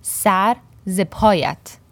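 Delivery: "Sar ze payat" spelled out very slowly, each word apart; slow careful demo. Shown as a demonstration. Teacher breaking down the phrase for learners, very slow and careful. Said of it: "sar is used by itself".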